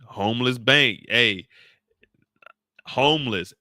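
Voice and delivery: normal talking voice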